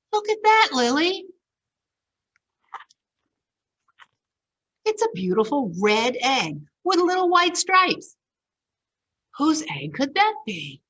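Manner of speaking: high, bright-eyed wonder at an egg; excited discovery. Acted. Milo shows Lily a strange red egg, excited. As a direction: point it out, thrilled and curious.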